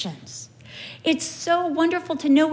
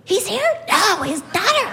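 high-pitched imitated exchange; excited mix-up; 6 semitones higher than usual. Two shoppers confusing Chuck with his daughter, excited. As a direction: act out a flustered celebrity sighting.

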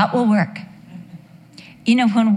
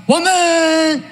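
husband's sleepy bark; 8 semitones higher than usual. Retold or imitated. Husband woken by a shove, grumpy yell. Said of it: "it's always".

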